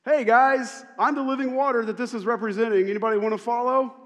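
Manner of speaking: loud, high and quick, like hailing friends; casual loud call. Retold or imitated. Jesus inviting the festival crowd, loud casual call. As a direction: grab everyone's attention, breezy. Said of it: "He just".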